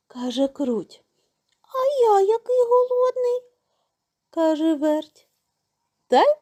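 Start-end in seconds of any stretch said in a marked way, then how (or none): 1.6-3.4 very high, whining mouse voice; high storybook mouse wail; hungry little mouse. A narrator's reading. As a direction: pipe up a big hungry complaint.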